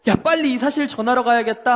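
high, excited cry of good news; joyful women. Acted. Women to each other, thrilled that Jesus lives. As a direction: bubble over with joy at the empty tomb. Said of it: "빈 무덤을 보고 기뻐서".

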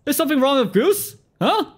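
high, loud offended squeak; mock indignant surprise. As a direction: act outraged on the goose's behalf. Mock shock at kid.

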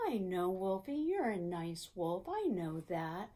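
calm the little wolf down gently; gentle reassuring. Real, not acted. Soothing the puppet wolf.

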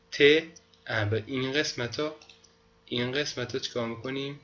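ordinary talk

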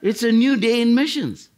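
high, loud exclamation of the theme; "new day" lifted high and loud; excited punchline. Acted. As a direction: throw it out high and loud with glee. Punchline after laughing.

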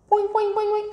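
very high, springy boings; imitated boing. Teacher imitating a spring for young learners, high springy boing. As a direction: bounce out quick high boings. Imitating bouncing sound in story reading.

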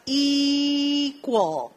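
equal drawn out in clear English, falling at the end; slow English model word. Shown as a demonstration. Teacher modelling a word for students, drawn out. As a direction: model equal slowly for the class. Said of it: "la palabra de dos sílabas".

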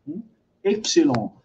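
usual speaking voice

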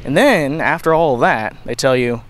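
normal talking voice

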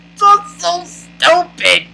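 high, laughing disbelief; exasperated laughter. Real, not acted. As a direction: squeeze the words out through laughter. Laughing at the stupid fight.

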